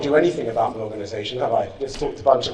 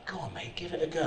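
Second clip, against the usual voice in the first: much softer, like a voice in the back of the head; soft encouraging inner voice; little inner voice; 15 dB quieter than usual. Retold or imitated. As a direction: murmur it as a quiet inner nudge. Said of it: "this little voice in the back of my head went".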